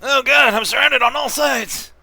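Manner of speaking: high, loud panicked monster voice; panicked mimic. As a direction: cry out like a cornered creature. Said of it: "says the Mimic".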